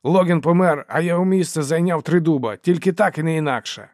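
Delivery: loud, high, urgent scolding; exasperated outburst. A narrator's reading. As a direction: shout them down and settle it. Dogman shouts down the quarrel. (обурено, гучно, високим голосом)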